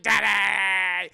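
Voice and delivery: loud, drawn-out mock wail; anguished cry. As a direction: bawl it out in hammed-up grief. Son cries over dead father.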